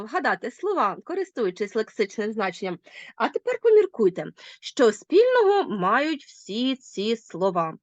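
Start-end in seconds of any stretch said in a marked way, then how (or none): none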